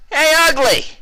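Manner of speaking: very high, loud taunting shout; mocking loud call. A narrator's reading. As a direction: yell to draw him round. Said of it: "she shouted".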